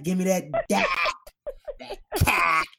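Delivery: "dak" and "cack" with the vowel of "cat"; playful short "a" in "cack"; Boston-style vowel. Shown as a demonstration. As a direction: rhyme "dak" and "cack" with "back". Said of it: "my favorite way to say cock".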